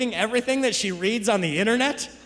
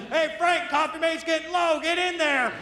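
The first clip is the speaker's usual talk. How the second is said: a hint of a higher pushy boss voice; bossy manager; 6 semitones higher than usual. Boss sends Frank to the beaver warehouse, light higher bark. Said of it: "They're like".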